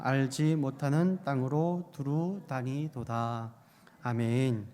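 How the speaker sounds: low, measured Bible reading voice; scripture reading. Real, not acted. Preacher reads the prophet's lament to the congregation, scripture reading. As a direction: read it solemnly, pitched low and even.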